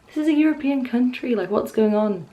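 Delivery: high-pitched incredulous protest; retold incredulity. Retelling her disbelief at the posters.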